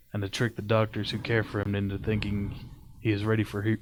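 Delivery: steady story reading voice; story narration. Acted. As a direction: read it like a creepy tale. Reads a creepypasta aloud.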